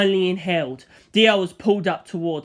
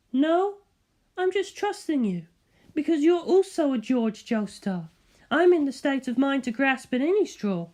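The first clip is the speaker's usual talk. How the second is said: a much higher woman's voice, composed and kind; Elizabeth's voice; 8 semitones higher than usual. Acted. Elizabeth radioing the narrator with a kind, higher woman's voice. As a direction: answer gently but firmly over the radio.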